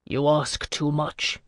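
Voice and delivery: slow, deadened reply; dull. A narrator's reading. Bouvard balking at Alcuin's price, dull. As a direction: drag it out dully, all hope gone. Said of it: "he said dully".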